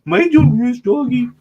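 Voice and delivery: high, slow doting voice; cooing baby talk. Retold or imitated. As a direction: croon it like a pet name. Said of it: "as long as you go".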